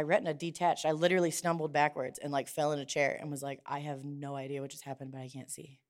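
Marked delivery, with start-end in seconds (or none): 3.7-5.8 stunned quiet. Retold or imitated. Quoting her stunned self.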